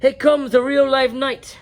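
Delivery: loud, high teacher dragon urging the class; bossy dragon teacher. A narrator's reading. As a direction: project like a drill teacher. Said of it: "said Madam Dragon".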